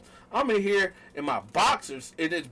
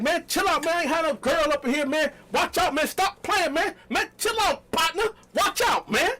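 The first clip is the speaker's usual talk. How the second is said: high, loud, frantic pleading; retold panic, louder and higher; pleading yell; 10 semitones higher, 3 dB louder than usual. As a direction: beg her to calm down, voice up high. Pleading with knife-wielding girlfriend.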